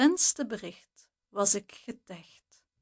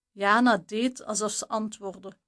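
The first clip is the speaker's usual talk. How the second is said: usual speaking voice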